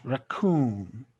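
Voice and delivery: Raccoon, second syllable "coon" stressed. Shown as a demonstration. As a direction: say Raccoon with a light first syllable and a full "coon". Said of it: "place the stress mark here".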